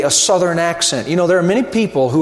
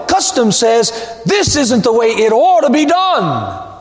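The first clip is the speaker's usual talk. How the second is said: preacher whipping up the congregation against custom; building preaching crescendo; 5 semitones higher than usual. Acted. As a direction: build the refrain step by step to a peak. Preacher builds a repeated litany.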